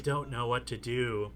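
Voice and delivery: hushed, hurried admission; murmured. A narrator's reading. Woman admitting confusion to the man, murmured. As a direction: keep it very soft and a little quick. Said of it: "she murmurs".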